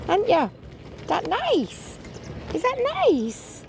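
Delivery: high, cooing pet voice. Real, not acted. Feeding a hungry stray puppy, cooing baby talk to him.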